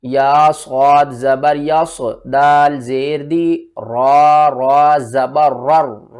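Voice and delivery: teacher spelling the word for students; spelling out slowly. Shown as a demonstration. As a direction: sound out each letter deliberately. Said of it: "ہجے کر کے سمجھاتا ہوں".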